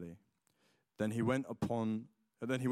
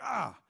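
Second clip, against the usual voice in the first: yelp of pain; 9 semitones higher than usual. Retold or imitated. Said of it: "I'm like".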